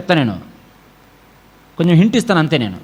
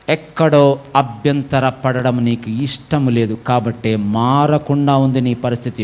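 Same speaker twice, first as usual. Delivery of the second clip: ordinary talk, nothing marked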